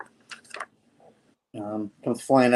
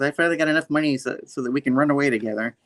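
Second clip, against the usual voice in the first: fast eager rush; 3 semitones higher, much faster than usual. Retold or imitated. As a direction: blurt it out quickly. Rushed character line.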